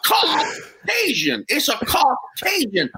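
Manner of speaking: "Caucasian" stretched slow and high; slow high Cajun "Caucasian"; Cajun mispronunciation. Retold or imitated. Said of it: "the Cajun version of the word".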